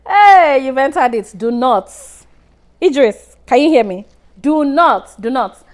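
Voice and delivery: urgent warning. Real, not acted. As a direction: call out to stop the viewer. Warning viewer Idris mid-scene.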